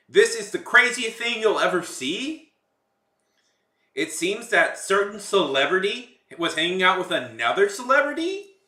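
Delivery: loud, high TikTok uptalk accent; TikTok uptick accent. Shown as a demonstration. Streamer showing viewers what TikTokers all do, TikTok uptick accent. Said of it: "uptick accent".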